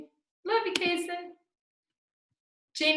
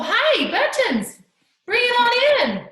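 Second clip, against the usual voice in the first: excited welcome; 10 semitones higher, 8 dB louder than usual. Real, not acted. Greeting child on call.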